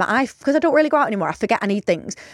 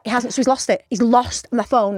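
normal talking voice

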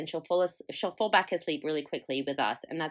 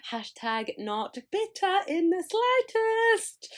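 high, slow, sugary sarcasm; sarcastic; 12 semitones higher, slower than usual. Real, not acted. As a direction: drawl it out, pretending not to be bitter. Sarcastic aside about sleep.

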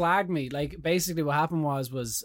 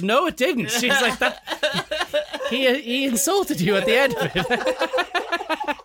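much higher, louder, laughing disbelief; girlfriend's exasperated retort; 11 semitones higher, 8 dB louder, much slower than usual. Retold or imitated. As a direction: set him straight, half laughing. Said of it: "And she goes".

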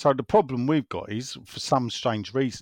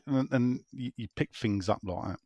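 ordinary delivery

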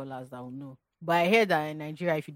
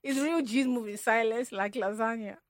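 Yoruba accent; 6 semitones higher, 7 dB louder than usual. Shown as a demonstration. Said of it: "The way I'm saying it is not sweet. This is the Yoruba".

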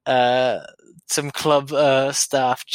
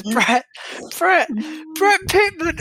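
rising higher with each call of the name; mock shout; 12 semitones higher, slower than usual. Podcast host mimicking a manager shouting a player's name. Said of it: "so he goes".

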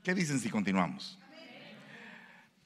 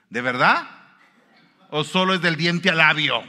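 loud doubting challenge, much louder; skeptical challenge; 5 semitones higher, 12 dB louder than usual. Real, not acted. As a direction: call out the fake repentance loudly. (desafío escéptico en voz alta)

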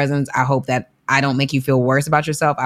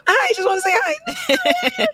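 much higher and slow, through laughter; laughing high voice; slower than usual. Retold or imitated. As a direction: squeal it out while laughing.

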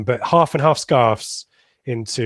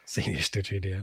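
soft echo; 4 semitones lower, 11 dB quieter than usual. Retold or imitated. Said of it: "Love that".

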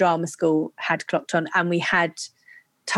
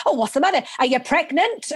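higher-pitched imitation of a mum; high, rising mock-concerned questions; worried mum; 8 semitones higher than usual. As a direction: lightly act out a mum's anxious questioning. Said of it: "she was like".

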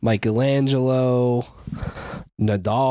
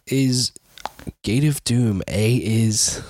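ordinary talk

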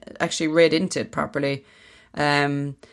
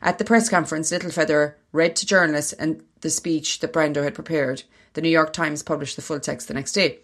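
reading aloud. Acted. Reads an article aloud.

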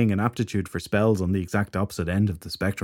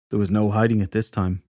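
ordinary delivery

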